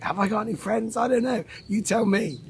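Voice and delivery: high-pitched mock self-doubt; mock questioning. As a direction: ask it high, playing at being unsure. Mock doubt about having friends.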